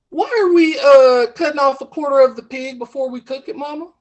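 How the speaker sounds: high, loud country caller questioning mama; hammed-up demand for an explanation from mama; puzzled daughter.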